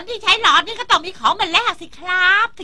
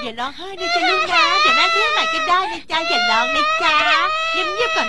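soothing mother; 10 semitones higher than usual. Acted. Mother soothing crying child.

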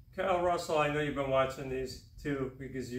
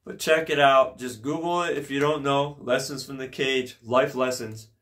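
louder promo pitch for a book; ad read; 7 dB louder than usual. Acted. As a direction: sell it like an ad.

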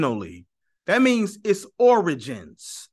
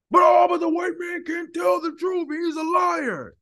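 mocking protester; 12 semitones higher than usual. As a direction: wail it like an indignant objector you are ridiculing. Mocking imagined objector's protest.

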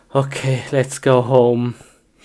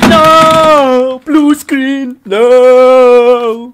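high, loud despairing yell; screaming no; 9 semitones higher, 14 dB louder, much slower than usual. Real, not acted. Gamer screams at the blue screen of death.

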